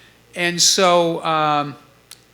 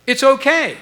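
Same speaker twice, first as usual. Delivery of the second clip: high, louder clipped answer; just tell them it's fine; short reply; 9 semitones higher, 6 dB louder than usual. Retold or imitated. Stu telling the band the verdict, high and short.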